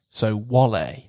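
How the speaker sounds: "wallet" said "wa-LAY", rhyming with ballet. Shown as a demonstration. Podcast host demoing a silly word swap, "wallet" as "wa-LAY". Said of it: "pronounced like ballet".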